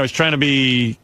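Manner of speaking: ordinary talk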